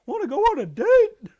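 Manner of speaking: very high, loud cartoon squeak; squeaky flirty voice.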